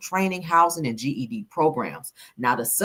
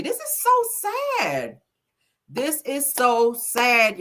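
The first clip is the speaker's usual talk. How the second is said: drawn-out lament; 7 semitones higher, much slower than usual. Real, not acted. Lamenting kids losing Job Corps.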